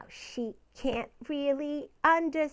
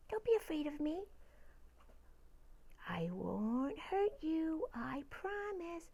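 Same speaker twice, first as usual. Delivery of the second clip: soft reassuring; 12 dB quieter than usual. Acted. Reassuring a timid pet.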